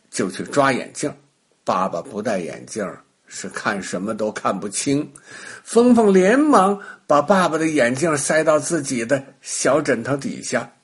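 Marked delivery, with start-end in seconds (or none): none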